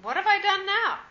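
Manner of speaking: David protesting, high and indignant; indignant retort. A narrator's reading. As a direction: fire back, stung and indignant. David's indignant retort read aloud.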